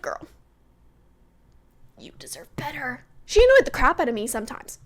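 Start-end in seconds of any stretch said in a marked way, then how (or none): 0.0-3.0 whispered advice. Pretend. Vlogger telling Kaylor she deserves better, whispered. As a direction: whisper with no voice, quiet and close. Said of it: "and I'm just like".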